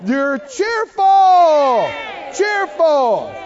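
cheerful drawn out and loud; preacher stressing the word cheerful. Shown as a demonstration. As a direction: lean hard into cheerful. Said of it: "Cheer-, not tearful".

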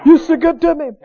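high, loud opening cry of praise; reliving an overjoyed shout to God; joyful shouting. Retold or imitated. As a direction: cry out, then keep repeating it up high. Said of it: "I got up and I got to shouting".

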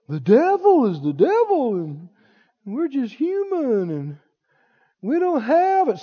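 mild put-on self-pity; excuse-making. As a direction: drag it out like a feeble excuse. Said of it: "See, excuses, excuses".

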